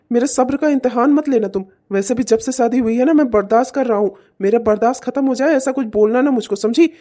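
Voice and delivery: ordinary talk, nothing marked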